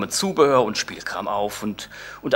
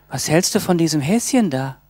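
put-on higher, childish coaxing; childlike voice; 6 semitones higher, 4 dB louder than usual. Girlfriend pleading for a bunny, higher childlike. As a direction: coax like a little girl. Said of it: "fragte sie mit kindlicher Stimme".

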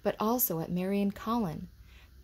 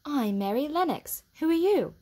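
put-on girl's voice; 9 semitones higher, 6 dB louder than usual. A narrator's reading. Mary answers Colin.

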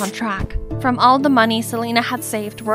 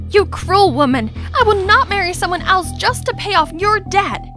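heated, higher-pitched refusal; angry defiance; 7 semitones higher than usual. Acted. Young woman refusing a forced marriage to pay debt, angrily defiant. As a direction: stand up and refuse outright. Defying Missus Sanchez in a quarrel.

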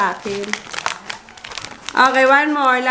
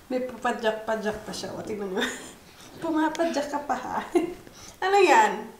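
usual speaking voice

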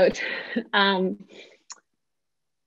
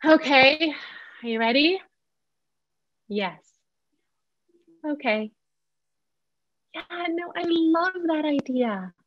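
yes tone; 7 semitones higher than usual. Shown as a demonstration. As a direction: say yes even when the answer is later. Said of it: "finding our yes tone".

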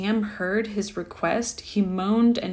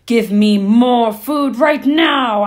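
shouting ghost; 3 semitones higher, 10 dB louder than usual. A narrator's reading. Ghost ordering Dan to cook right now, loud shout. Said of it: "cried the ghost".

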